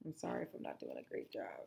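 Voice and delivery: sheepish aside about the voice; quiet aside. Real, not acted. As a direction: mutter it aside to the class. Aside to class, apologetic.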